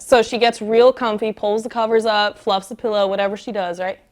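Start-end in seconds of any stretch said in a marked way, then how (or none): none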